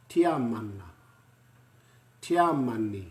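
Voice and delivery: "Tiamani" tee-ah-ma-nee, loud and clear; Māori pronunciation. Shown as a demonstration. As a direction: model the German country name in Māori. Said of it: "That's the one".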